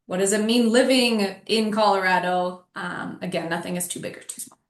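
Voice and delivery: usual speaking voice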